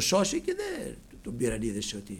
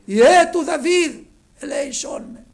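high, loud pleading cry; 10 semitones higher, 12 dB louder than usual. Acted. Blind beggar crying out to Jesus for mercy, loud plea. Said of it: "Φώναζε δυνατά".

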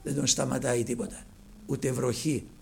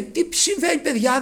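high, eager call of the blind beggar; blind beggar asking; 8 semitones higher, 6 dB louder than usual. Acted. Blind beggar asking the crowd what is happening, eager. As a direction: ask what's happening, puzzled and eager. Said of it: "Λέει".

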